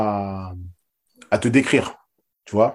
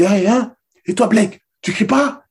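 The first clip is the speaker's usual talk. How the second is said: much higher, loud fired-up call; coach's challenge; 11 semitones higher, 5 dB louder than usual. Retold or imitated. Coach in the locker room goading Blake to scream, loud and high. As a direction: dare him to scream, all fire. Coach pushing players to shout.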